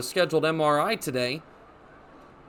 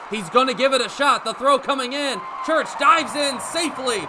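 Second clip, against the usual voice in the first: much higher and louder, shouting the close play; excited play call; 8 semitones higher, 6 dB louder than usual. Real, not acted. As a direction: build to a shout as the runner dives in. Runner dives into second base.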